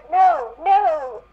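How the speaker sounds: hammed-up cry of protest; a touch of a pleading wail; mock cry. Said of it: "she cried".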